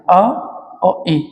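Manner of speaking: अ और ए drawn out very slowly; slow vowel blend. Shown as a demonstration. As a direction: stretch अ और ए to show them merge. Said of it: "जैसे मैं बात करूँ ए".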